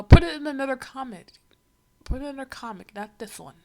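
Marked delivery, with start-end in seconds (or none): 0.0-1.3 much louder, a sudden exasperated shout; loud outburst. Real, not acted. Reacting to the comic, telling it to go elsewhere, loud exasperated shout. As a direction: blurt it out loud and fed up.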